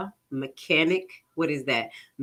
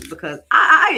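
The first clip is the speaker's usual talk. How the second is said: excited burst; 9 semitones higher, 9 dB louder than usual. Real, not acted. Enthusiastic sign-off to viewers.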